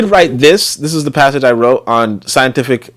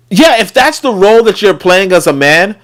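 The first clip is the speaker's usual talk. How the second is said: ordinary talk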